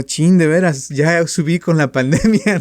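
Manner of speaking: loud, high, laughing dismay; mock horror at pandemic weight; dismayed laughing self-talk. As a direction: groan it out with a laugh, caught off guard. Acted reaction to weight comment.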